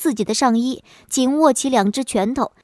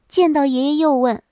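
plain reading voice

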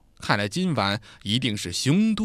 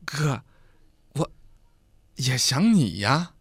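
awkward, hesitant and slow; embarrassed reply to the husband; sheepish guest; slower than usual. A narrator's reading. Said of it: "讪讪地对梁子说".

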